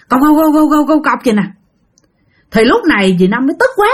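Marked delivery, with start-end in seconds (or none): 0.1-1.2 nonstop imitated clacking; continuous clog knocks. Storyteller showing nonstop clogs outside the room, rapid knocking. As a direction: knock out the footsteps in an unbroken run. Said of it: "Mà nó liên tục luôn".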